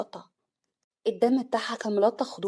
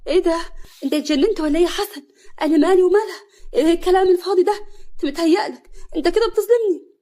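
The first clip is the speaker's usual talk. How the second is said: much higher, loud fake outrage; feigned shock; 9 semitones higher, 12 dB louder than usual. Nawal faking shock before the officer, high and loud. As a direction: overplay the shock to fool them. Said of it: "نوال بتمثيل صدمه".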